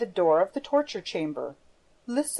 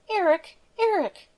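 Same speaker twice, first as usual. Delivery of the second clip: put-on much higher, pleading call of a young woman; pleading cry; 11 semitones higher than usual. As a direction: cry his name out, begging him. Christine cries out to Erik.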